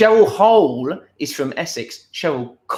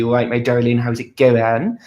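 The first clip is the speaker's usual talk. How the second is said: West Country accent. Shown as a demonstration. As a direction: lay the West Country accent on thick and friendly. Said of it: "very West Country".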